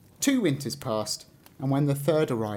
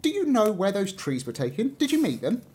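put-on curious young tree; 7 semitones higher than usual. A narrator's reading. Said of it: "it asked".

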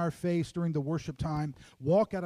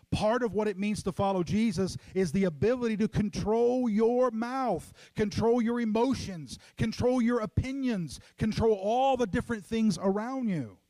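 preaching crescendo; 5 semitones higher than usual. Acted. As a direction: drive the point home from the pulpit. Preaching climax louder higher.

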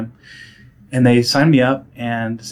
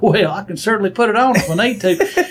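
hint of Southern accent; 9 semitones higher, 6 dB louder than usual. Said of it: "you don't have much of an accent".

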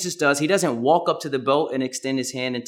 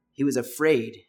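ordinary delivery

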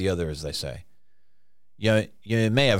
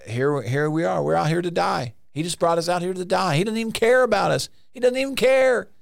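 mock panic climbing higher and higher; freaking out; 7 semitones higher than usual. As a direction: imitate disciples working up into a high-pitched complaint. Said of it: "the disciples were freaking out".

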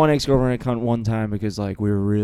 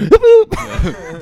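mock hiccup noises; 7 dB louder than usual. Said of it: "He's like".

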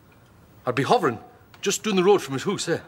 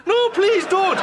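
higher, hammed-up pleading; mock begging; 12 semitones higher, much slower than usual. His old mate begging him from the garage roof, mock pleading. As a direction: beg for mercy, hammed up. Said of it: "He's begging us".

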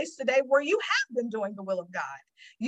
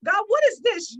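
higher, louder exasperated cry to God; frustrated outburst; 9 semitones higher, 6 dB louder than usual. Retold or imitated. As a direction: throw the question up in exasperation. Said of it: "I get very frustrated".

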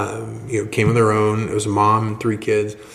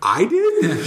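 higher, startled "I did?"; surprised question; 8 semitones higher than usual. Retold or imitated. Bob surprised he invited the lady, higher. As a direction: sound caught off guard.

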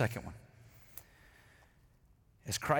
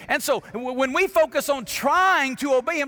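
normal talking voice

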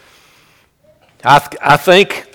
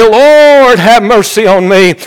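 high, raised preacher's plea, long-held opening words; crying out; 6 semitones higher, 3 dB louder than usual. Retold or imitated. Blind man begging Jesus for mercy, crying out. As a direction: call out pleadingly, stretching the first word. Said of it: "he begins to cry out and say".

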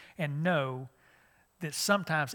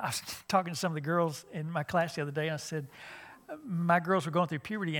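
usual speaking voice

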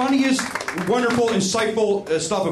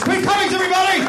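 big, high-pitched applause call; announcer's shout; 12 semitones higher, 5 dB louder, faster than usual. Real, not acted. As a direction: shout it to the whole crowd. Host calls for applause for player.

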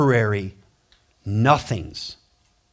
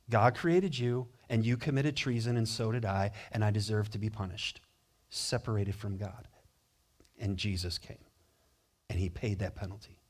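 quiet, earnest confession; hushed; 6 semitones lower, 12 dB quieter than usual. Shown as a demonstration. Preacher to congregation, hushed and earnest. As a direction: drop the voice low and soft. Preacher voicing the gospel message softly.

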